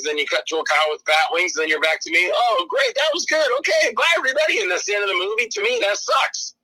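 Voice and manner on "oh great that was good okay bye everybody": high, over-chirpy wrap-up; hammed-up breezy goodbye; mock cheery sign-off. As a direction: wave everyone off in a silly high voice. Mocking abrupt movie ending.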